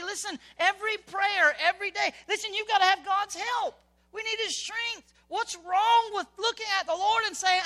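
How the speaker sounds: high, loud impassioned preaching; full-force preaching. Real, not acted. Preacher pleading with the congregation to seek God's help, impassioned. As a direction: preach it hard and lift the room.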